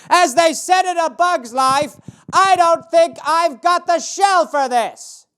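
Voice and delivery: high, slow put-on voice; mock movie quoter. A customer quoting a movie line in a put-on voice. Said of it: "He must have literally been like".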